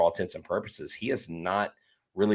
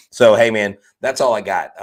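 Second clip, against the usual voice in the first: exasperated outburst; 3 semitones higher, 10 dB louder than usual. Real, not acted. Exasperated wrap-up after loss.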